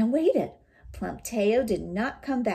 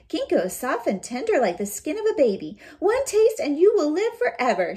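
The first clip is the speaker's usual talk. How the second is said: put-on clever child; 7 semitones higher, 6 dB louder than usual. Child tempting wolf.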